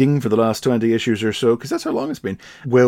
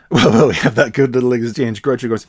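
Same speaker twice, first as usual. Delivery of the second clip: usual speaking voice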